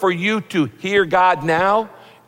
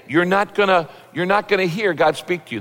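usual speaking voice

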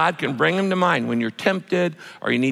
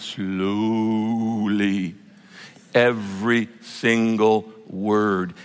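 drawn-out "slowly", then word by word; slow, deliberate; 5 semitones lower, much slower than usual. Shown as a demonstration. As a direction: stretch it out, lower and unhurried. Illustrates his slow Bible reading.

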